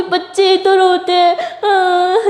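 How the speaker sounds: put-on high, whiny childlike wail; mock crying. A narrator's reading. Tara insists that children do cry, pretend crying. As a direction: whine it through pretend tears. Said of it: "तो तारा रोने लग जाती है".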